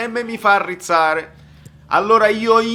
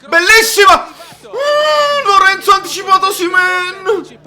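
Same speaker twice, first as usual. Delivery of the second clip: high, loud goal scream; excited shout; 11 semitones higher, 5 dB louder than usual. Real, not acted. Goal celebration.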